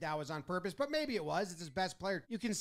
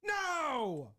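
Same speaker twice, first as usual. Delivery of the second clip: high, whiny manager protest, far above his own voice; hammed-up pleading no; Michael Scott style protest; 7 semitones higher than usual. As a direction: wail no like a sitcom boss losing it. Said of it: "Roberts goes straight Michael Scott on him".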